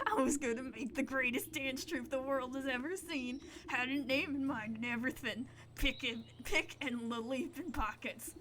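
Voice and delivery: hammed-up sobbing bandit; blubbering bandit. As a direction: bawl it out like a heartbroken brute. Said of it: "great shuddering sobs".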